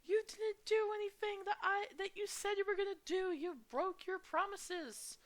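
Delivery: high, hammed-up scolding friend; mock hurt friend. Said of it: "I can be like".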